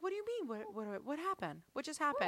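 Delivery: soft, high feigned bewilderment; playing innocent. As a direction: echo it quietly as if clueless. Mom echoing kids, playing innocent.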